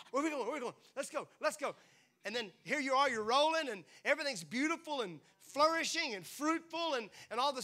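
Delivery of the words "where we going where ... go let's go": eager puppy. Retold or imitated. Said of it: "kind of like that little puppy dog".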